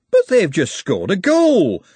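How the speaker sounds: indignant, drawn-out objection; slow, puzzled protest. Acted. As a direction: protest slowly, baffled by the rules. Hoggett protesting the rules.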